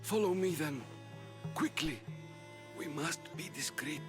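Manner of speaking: anxious, secretive priest; furtive urgency. Acted. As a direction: urge them along discreetly. Priest furtively leads a seeker away.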